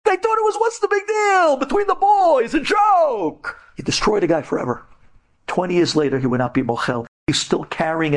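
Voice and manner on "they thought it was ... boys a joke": dismissive bullies. Retold or imitated. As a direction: shout it off as a harmless joke.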